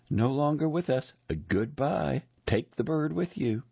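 high-pitched, dismissive woman's voice; curt female employee. A narrator's reading. Annoyed woman dismisses the narrator.

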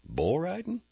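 high, rising question; startled question; surprised Ennis. Acted. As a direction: ask with sudden interest.

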